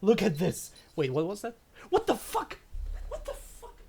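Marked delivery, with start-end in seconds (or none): 1.9-2.6 real shock, voice jumping high; startled high outburst; shocked exclamation. Real, not acted. Shocked at a surprise number. 3.1-3.8 breathless high whisper of disbelief; hushed incredulous whisper; stunned whisper. Real, not acted. Stunned whisper after the outburst.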